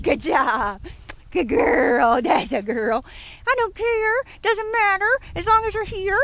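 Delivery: high doggy-talk gushing; excited dog praise. Real, not acted. Trainer telling Daisy it's fine, high happy praise.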